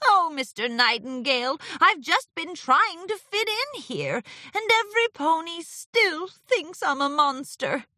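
high, theatrical woe; dejected Discord, lamenting. Acted. Discord just trying to fit in, lamenting.

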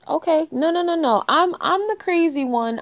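high sarcastic disbelief. Real, not acted. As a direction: scoff it out, pitch up high. Sarcastic girlfriend mid-argument.

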